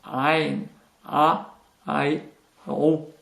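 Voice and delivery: "Ein, a, ai, ro" each begun from the back of the throat; deep throat sound. Shown as a demonstration. Teacher demonstrating a very complicated letter, deep throat sound. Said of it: "du fond de la gorge".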